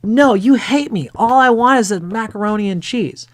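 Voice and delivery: put-on high, loud child's whine; little boy whining at his mother, high and loud; whiny little boy. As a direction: protest like a spoiled kid refusing dinner. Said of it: "Boy says".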